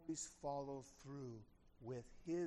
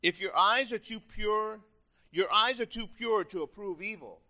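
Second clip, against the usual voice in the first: loud, emphatic declamation; scripture reading; 4 semitones higher, 8 dB louder than usual. Acted. Preacher reading Habakkuk's verse to congregation, loudly declaimed.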